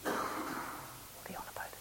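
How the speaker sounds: unvoiced stage whisper, slow. Real, not acted. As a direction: breathe it as a slow aside. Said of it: "aside after Peter's rambling".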